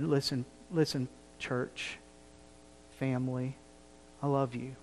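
soft, near-whispered words of love; tender plea. Pretend. Preacher softly telling his church family he loves them. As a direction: quietly draw them close and say you love them. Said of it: "It would be as though tonight".

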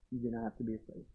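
hushed, breathy repeat; soft whisper of comfort; whispered reassurance. Shown as a demonstration. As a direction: lean in and whisper it to them. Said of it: "I can say this with confidence".

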